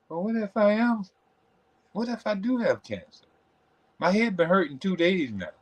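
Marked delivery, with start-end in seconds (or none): 0.1-3.3 worried inner voice. Retold or imitated. Acting out catastrophic worry.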